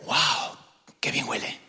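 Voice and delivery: hushed breathy "Wow, qué bien huele"; whispered admiration. Pretend. Passerby catching a lovely scent, whispering in admiration. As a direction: whisper it like a delighted secret.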